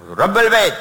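رب البيت thrown out high and loud; high, forceful stress on رب البيت; emphatic correction. Real, not acted. Emphatic answer to his own question.

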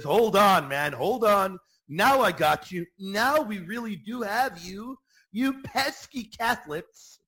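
a hammed-up sceptic crowing over the pesky Catholics; mock gloating objector. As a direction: ham up a smug challenger.

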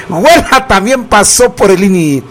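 breaking into real laughter mid-line; can't hold it together, laugh as you say it; laughing through it. Real, not acted. Radio host repeats the grandma joke, laughing.

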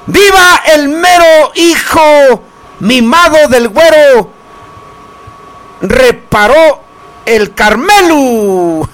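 high, long drawn-out cheers; stretched festive cries, falling at the end; festive viva shouts. Acted. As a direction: call out toasts like a fiesta announcer. Radio host calling out vivas.

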